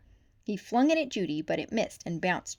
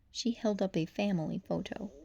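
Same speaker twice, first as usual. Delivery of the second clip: plain reading voice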